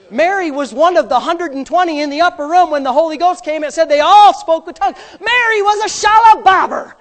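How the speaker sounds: loud, high, building to a shout; full-force preaching. Acted. Preacher telling congregation Mary spoke in tongues, building shout. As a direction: climb to a shouted punchline.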